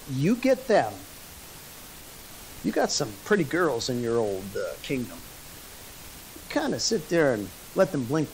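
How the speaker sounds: low, hushed conspirator's voice; feed the king the plot softly, with pauses; acted sly counsel. Balaam slyly advising the king.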